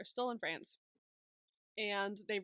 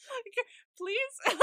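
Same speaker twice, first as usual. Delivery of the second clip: high, laughing little plea; mock plea; 12 semitones higher, 4 dB louder than usual. Handcuffed pair plead with the guards, mock plea. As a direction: beg playfully, pitched up.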